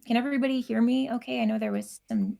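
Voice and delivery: usual speaking voice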